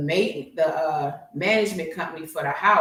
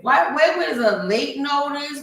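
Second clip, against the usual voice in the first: indignant; 7 semitones higher than usual. Retold or imitated. Continuing outrage at the bill.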